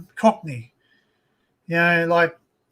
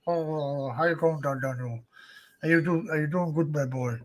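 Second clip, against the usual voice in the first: lower, marble-mouthed British uncle impression; Philip; 4 semitones lower, 4 dB quieter than usual. Nephew imitating his uncle in England, marble-mouthed. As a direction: mumble it low, as if talking round marbles. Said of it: "Like, he talks like that".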